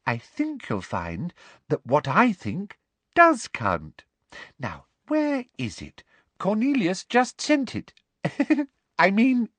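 simper with a false little giggle near the end. A narrator's reading. Umbridge asserting her authority over the professor, simpering. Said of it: "simpered Professor Umbridge".

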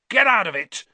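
furious high roar. Acted. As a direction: bellow it out at the cat. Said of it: "Ron roared".

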